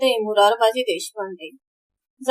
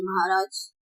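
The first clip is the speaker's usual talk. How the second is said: softly deferential address; respectful; 3 semitones lower, 6 dB quieter, slower than usual. A narrator's reading. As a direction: address your lord with quiet respect. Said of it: "Hanumantrao अदबीने म्हणाले".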